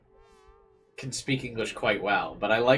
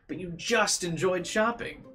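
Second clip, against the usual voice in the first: slow, higher line with "just" punched; stress on just; 9 semitones higher than usual. Shown as a demonstration. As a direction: show the right emphasis by leaning hard on "just". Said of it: "has the right emphasis on".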